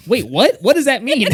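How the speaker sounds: mock alarm. Mock alarm at throwing off ship.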